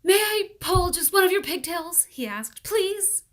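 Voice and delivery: put-on boy pleading. A narrator's reading. Paul asks to pull pigtail.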